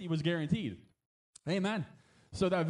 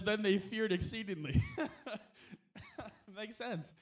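laughing while reading; 3 semitones higher, 5 dB quieter, slower than usual. Real, not acted. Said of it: "laughs while reading".

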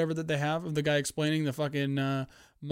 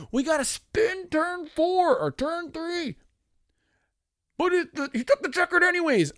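very high, slow, put-on voice; mock commentators; 4 dB louder than usual. Mocking the TV crew at the finish, high put-on voice. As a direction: parody the broadcasters' call. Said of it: "they go".

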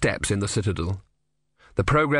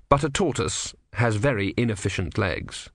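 ordinary delivery

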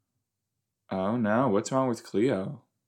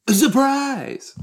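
excited; 13 semitones higher, 3 dB louder than usual. Acted. Reading the episode title out.

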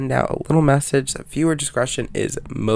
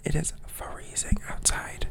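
soft whisper aside; 3 semitones higher, 11 dB quieter than usual. Real, not acted. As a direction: breathe it out as a whisper. Whispered aside about the cold.

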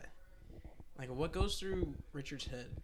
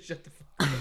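louder, a touch of a snarl; mock snapped insult; 2 semitones higher, 7 dB louder than usual. Drunk Richard telling Danielle off, a mock snarl. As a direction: fire it back at her. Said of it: "He said".

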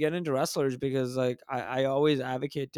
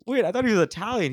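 higher, louder, rapid disbelief; retold confusion; 7 semitones higher, 5 dB louder, faster than usual. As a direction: blurt it out as a baffled reenactment. Retelling his confused reaction to buddy.

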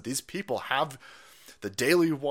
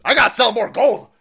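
building to a much louder, higher yelp on gold; excited exclamation; 7 semitones higher, 11 dB louder than usual. Retold or imitated. Retelling his reaction to the Daily Wire money, building excited shout. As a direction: start amazed, then shout the sales plan. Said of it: "I'm sitting there going".

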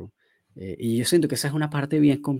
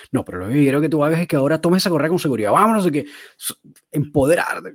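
fast, raised-pitch pep talk; motivational speech; 3 semitones higher, 5 dB louder, faster than usual. Retold or imitated. Trainer empowering a client to take the leash, motivational pep talk. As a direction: urge the client on with brisk insistence. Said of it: "Como todo este speech motivacional".

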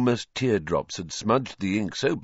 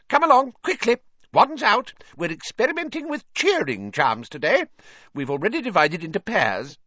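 high-pitched, brisk teacher; Professor Flitwick; 13 semitones higher than usual. A narrator's reading. Flitwick hurrying latecomers into Charms class, brisk and high.

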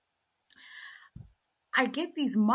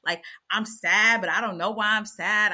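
ordinary delivery

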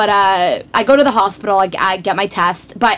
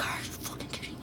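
whispered disbelief, unvoiced; 23 dB quieter, faster than usual. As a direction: breathe it out in shocked disbelief. Shocked inner reaction, whispered.

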